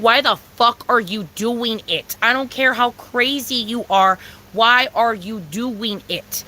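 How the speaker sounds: slow, drawn-out demanding question; slow emphatic. Real, not acted. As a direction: press each word slowly. Rant, slowed for emphasis.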